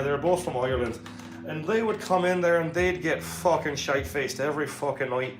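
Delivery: loud, animated Irish brogue; Irish accent. Shown as a demonstration. Streamer telling of two Irish regulars at the bar, loud put-on Irish accent. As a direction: tell it like a rowdy Irish pub yarn.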